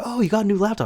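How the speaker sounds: high-pitched, impressed question; excited coworker. Retold or imitated. Coworker spotting a new laptop, excited. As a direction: perk up with a high voice.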